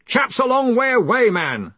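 high, loud retort; Ridcully. Acted. Arch-Chancellor justifying his loudness to his assistant, loud retort. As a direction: call it out, brushing him off. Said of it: "said Ridcully".

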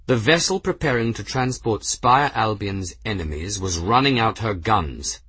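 ordinary delivery

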